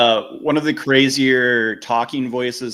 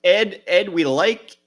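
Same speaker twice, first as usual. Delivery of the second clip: higher, folksy Midwest cop; mock cop; 9 semitones higher than usual. Cop confronting Ed Gein about his projects, folksy. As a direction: scold Ed like a polite Fargo cop.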